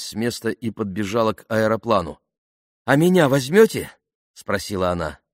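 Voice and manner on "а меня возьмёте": eager request. A narrator's reading. Woman eagerly asking for a ride.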